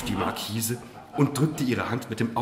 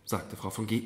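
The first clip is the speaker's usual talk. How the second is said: ordinary delivery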